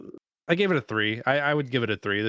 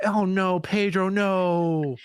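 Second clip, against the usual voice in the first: high, dismayed "oh no"; alarmed protest, voice up high; 6 semitones higher, much slower than usual. Real, not acted. Dismay at Pedro being villain.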